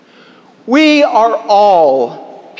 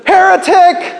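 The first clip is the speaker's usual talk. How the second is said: outraged shout; 11 semitones higher, 4 dB louder than usual. Retold or imitated. Driver shoves jumper off bridge.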